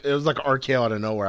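ordinary talk

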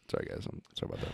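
fast, whispered sorry; whispered apology. Real, not acted. Co-host quickly apologizing for a noise, whisper.

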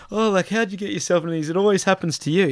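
mock motherly wonder; mum's exasperated voice. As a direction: play the fussing mum in disbelief. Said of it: "You're like".

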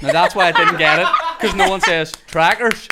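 loud laughing protest. Real, not acted. Actor excusing the botched accent to friends, laughing. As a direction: crack up while explaining, voice lifted. Laughing at own audition tape.

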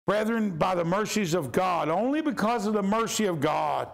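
fervent sermon climax; preaching at full force. Real, not acted. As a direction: lift the plea up high.

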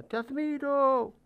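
high, slow call across the valley. A narrator's reading. Father calls across the mountain, slow ringing call. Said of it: "el padre grita a través de la montaña".

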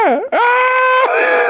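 two cartoon game screams; scream emote. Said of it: "came back as Screams Malloy".

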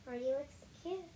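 ordinary delivery

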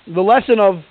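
ordinary talk, nothing marked

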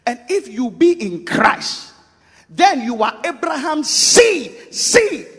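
high, loud, slow proclamation; preaching shout. Real, not acted. Preacher shouting Abraham's seed to the congregation.

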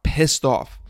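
ordinary delivery, nothing marked

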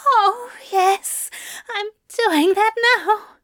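high, breathy strained voice; breathless and strained. Acted. Aziraphale breathless on the phone.

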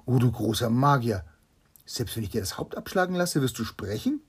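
barely contain your eagerness; trembling excitement. Acted. King asking the magician in awe, loud and excited. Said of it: "zitternd vor Erregung".

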